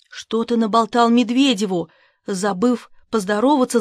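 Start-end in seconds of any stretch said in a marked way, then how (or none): none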